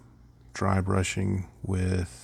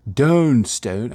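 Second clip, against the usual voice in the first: Dawn Stone in a loud, higher hammed-up southern drawl; southern accent; 4 semitones higher, 9 dB louder than usual. Shown as a demonstration. Painter announcing the paint name, hammed-up southern accent. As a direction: belt out "Dawn Stone" like a southern good ol' boy. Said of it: "I have to say it with a southern accent".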